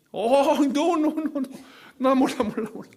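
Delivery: high, loud flustered denial, tripping over no; panicked denial. Retold or imitated. Peter cornered by a servant girl, loud flustered denial. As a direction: act out a cornered man denying everything. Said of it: "막 그러니까 뭐라 그래?".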